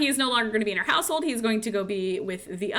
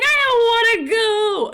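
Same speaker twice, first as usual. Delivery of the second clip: high whiny plea, much higher and louder; scared son; 12 semitones higher, 9 dB louder than usual. Retold or imitated. Son begging his sister not to send him to war, high whine. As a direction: beg like a frightened boy who won't leave. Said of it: "He's like".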